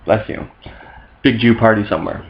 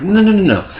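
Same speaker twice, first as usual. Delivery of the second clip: high Mary protest; 10 semitones higher than usual. Acted. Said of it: "Mary's like".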